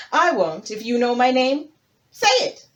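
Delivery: loud, rising high challenge; demanding hippo king. Acted. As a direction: dare the tortoise to say it.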